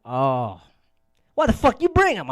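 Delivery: high, loud exasperated cry; loud self-scold. Retold or imitated. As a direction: yell at yourself in disbelief. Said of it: "later, I was like".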